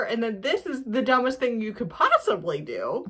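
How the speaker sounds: normal talking voice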